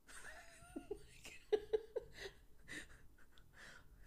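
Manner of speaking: long high-pitched breathy laugh; real laugh at the memory. Real, not acted. Storyteller remembering her son's request, high laughter.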